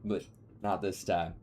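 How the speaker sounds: usual speaking voice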